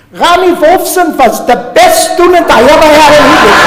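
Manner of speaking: put-on loud, high Lithuanian-accented old-teacher proclamation; booming, comic Lithuanian accent. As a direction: announce it to the whole congregation in a Lithuanian accent. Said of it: "he says in his Lithuanian accent".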